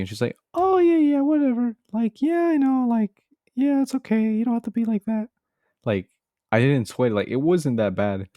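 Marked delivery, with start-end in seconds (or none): 0.5-5.3 very high-pitched mock girl; mock girl's voice. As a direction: put on a squeaky-high girl brushing it off. Voicing the girl's casual reply.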